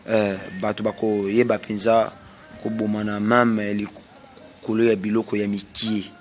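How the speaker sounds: usual speaking voice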